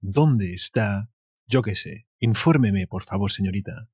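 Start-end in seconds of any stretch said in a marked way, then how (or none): none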